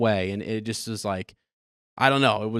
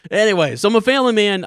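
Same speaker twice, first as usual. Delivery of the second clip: much higher and louder, still laughing; a high, loud, laughing "family man"; 10 semitones higher, 10 dB louder than usual. Real, not acted. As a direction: push on through the laugh. Recovering with a laugh.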